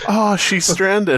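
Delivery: slow, high stretched-out mock pity; slow drawn out. Mock whale-rescue remark.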